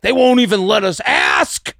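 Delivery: high, slow shout of grievance; mock outraged complaint. Imagines the aggressive guy complaining today.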